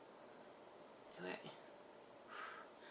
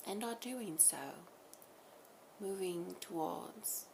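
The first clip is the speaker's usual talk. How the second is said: channeled message; 2 semitones higher, 6 dB quieter than usual. Acted. Collective speaking through her to the listener, soft and hushed.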